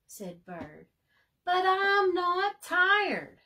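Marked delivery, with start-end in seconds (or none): 1.4-3.4 high and slow, half sung; sing-song defiance. A narrator's reading. Bird refusing bedtime, slow sing-song protest. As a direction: drag it out like a stubborn kid.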